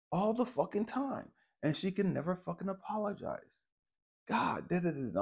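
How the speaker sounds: very high exasperated rant; pissed. Retold or imitated. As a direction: act out an indignant plea to God. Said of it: "I'm pissed. And so I'm outside and I'm talking to God".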